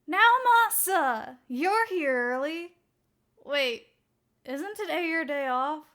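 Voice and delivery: loud, bright, drawn-out call across the room; loud greeting. Acted. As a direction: call out cheerfully to a colleague. Coworker greets loudly at door.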